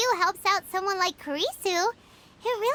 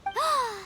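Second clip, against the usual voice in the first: shocked gasp; 3 semitones higher than usual. Acted. Shocked at the bully.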